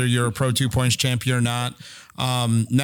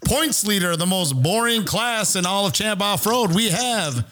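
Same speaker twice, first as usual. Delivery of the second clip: announcer voice; 6 semitones higher, slower than usual. Acted. Introducing next guest on show.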